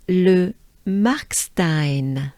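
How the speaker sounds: Le Markstein very slowly, with French R; whole French name. Shown as a demonstration. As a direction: draw out Le Markstein for learners to echo. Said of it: "Do it with me".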